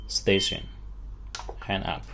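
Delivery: ordinary talk, nothing marked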